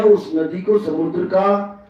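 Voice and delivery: normal talking voice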